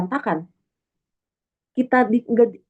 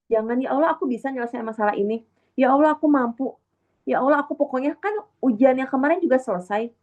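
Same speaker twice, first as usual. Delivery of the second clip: higher, self-assured bragging to God; mock boastful prayer; 5 semitones higher, faster than usual. Speaker imitating an arrogant believer's prayer, mock boastful. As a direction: show off your strength, mock proud. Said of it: "jangan sombong".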